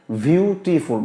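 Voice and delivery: "বিউটিফুল" broken into three separate beats; syllable by syllable. Shown as a demonstration. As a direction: demonstrate three breaths in "বিউটিফুল", one per syllable. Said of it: "মানে আপনার তিনটা দম লাগছে". ("বিউটিফুল" তিন দমে ভেঙে ভেঙে উচ্চারণ)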